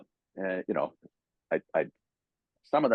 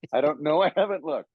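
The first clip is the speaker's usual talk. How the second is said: Cyclops voice; 6 semitones higher than usual. Retold or imitated. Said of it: "And he said".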